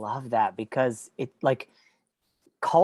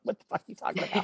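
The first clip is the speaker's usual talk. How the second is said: fast, softer mock disbelief, voiced unlike herself; quick hushed mock retort; baffled outburst; 5 semitones higher, 7 dB quieter, much faster than usual. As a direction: toss it off as a confused skeptic would. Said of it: "they're gonna be like".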